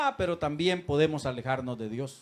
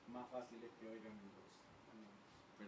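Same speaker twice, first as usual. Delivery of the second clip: very soft, slow and low; barely audible, drawn-out words; hushed up close; 7 semitones lower, 27 dB quieter, slower than usual. Shown as a demonstration. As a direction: murmur it right into a listener's ear. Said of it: "yo le puedo hablar bajito".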